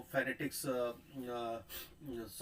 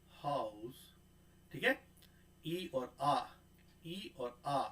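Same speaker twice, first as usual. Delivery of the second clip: normal talking voice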